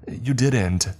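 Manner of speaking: high, pained insistence; anguished denial. A narrator's reading. Friend refusing to believe the killing, anguished. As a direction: insist on it, pitch jumping up. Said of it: "I wanted to scream".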